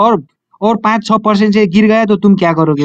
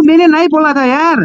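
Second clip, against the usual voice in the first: high-pitched, pleading denial; wounded protest to an accuser; 6 semitones higher than usual. Acted. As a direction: insist on your innocence, pitch raised. Denies an accusation of lying.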